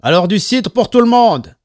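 high, loud order to the bar; calling out. A narrator's reading. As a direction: call the round out loudly.

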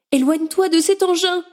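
high urgent plea; frightened warning. A narrator's reading. Wife warning her husband off the jet, high frightened plea. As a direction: warn him off, scared. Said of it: "s'écria la femme".

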